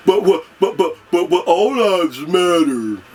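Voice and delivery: mock their stammering objection; mocking impression. Mocking people who say all lives matter, sputtering. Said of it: "other Americans out there going".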